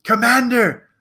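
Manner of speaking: hammed-up high, desperate plea; straining movie hero. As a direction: beg while straining to lift. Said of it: "he's trying to lift, and he's like".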